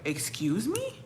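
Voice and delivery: imitating an offended, rising question. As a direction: act taken aback, voice climbing. Acted offended reply in retold quarrel.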